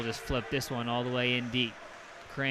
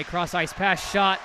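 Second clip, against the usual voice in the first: building to a loud, high play-by-play cry; real live hockey goal call; excited goal call; 6 semitones higher, 8 dB louder than usual. Real, not acted. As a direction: lift sharply as the puck goes in, stay up.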